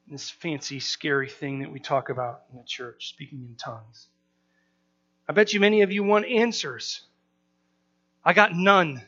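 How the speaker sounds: normal talking voice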